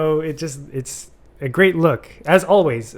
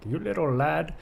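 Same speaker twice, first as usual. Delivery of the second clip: higher, softer put-on sailor voice; Wake's old sailor; 4 semitones higher, 5 dB quieter than usual. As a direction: playfully mimic a salty old sailor. Imitating Wake calling Ephraim lad.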